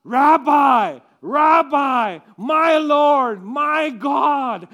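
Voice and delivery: loud, high cry of joy; joyful recognition. Acted. Mary recognising Jesus, shouting with joy.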